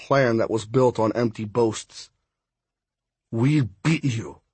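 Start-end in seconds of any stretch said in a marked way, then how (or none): 3.3-4.4 forced through gritted teeth. Acted. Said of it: "through gritted teeth".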